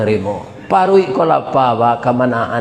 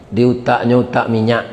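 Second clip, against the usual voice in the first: usual speaking voice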